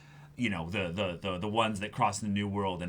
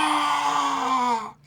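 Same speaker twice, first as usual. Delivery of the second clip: high, loud anguished cry; dying scream; 7 dB louder than usual. Acted. Fan voicing the hero's death cry, high and loud. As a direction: scream as if shot.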